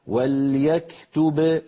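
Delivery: وليكتب said with a full stop on the final ب; وليكتب read slowly, ending on a bounced ب; stop on the word. Shown as a demonstration. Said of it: "لو وقفنا".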